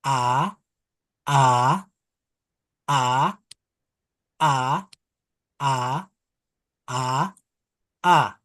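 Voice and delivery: teacher repeating "a"; vowel drill. Shown as a demonstration. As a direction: open "a" repeated evenly. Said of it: "So now I make the sound again".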